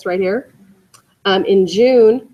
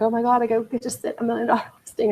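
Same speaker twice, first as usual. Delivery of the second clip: higher, quick, giddy and breathless, with a laugh; gushing excited agent; 4 semitones higher, 5 dB quieter, faster than usual. Retold or imitated. Mimicking an agent thrilled to sit a million dollar listing, giddy and fast. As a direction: gush like a newbie who just landed a big listing. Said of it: "a lot of people get all excited".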